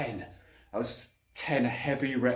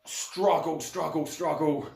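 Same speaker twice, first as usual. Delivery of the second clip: straining; 5 semitones higher, much slower than usual. Retold or imitated. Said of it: "So literally".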